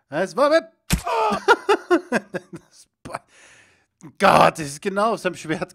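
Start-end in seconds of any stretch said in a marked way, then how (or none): 0.9-2.5 high burst of laughter; helpless high-pitched laugh; real laughter. Real, not acted.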